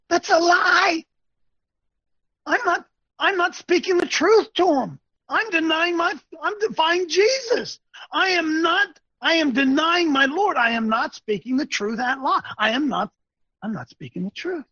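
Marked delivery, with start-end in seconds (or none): 0.1-10.6 high-pitched, heated declaration; impassioned preaching. Real, not acted. Preacher confessing he is denying his Lord, impassioned cry. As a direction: preach it urgently with pitch raised.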